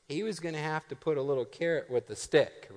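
normal talking voice

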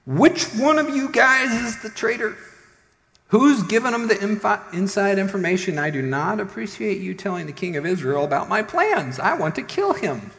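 high, loud, indignant outburst; upset king. Acted. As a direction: rail at your men like a betrayed king. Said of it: "got kinda upset".

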